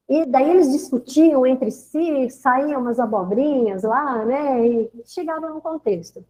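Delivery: ordinary talk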